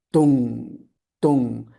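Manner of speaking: "dong" as a single low ringing hit; struck single notes. Shown as a demonstration. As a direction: say "dong" like hitting something, one low falling stroke. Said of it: "like hitting things".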